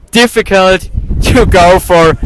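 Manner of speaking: chuckling through the words; laughing. Real, not acted. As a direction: laugh while saying it. Laughing at wind chaos.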